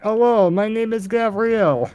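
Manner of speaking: over-the-top mock accent; mock Russian accent. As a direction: parody a thick accent, high and loud. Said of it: "All I could think of when you said".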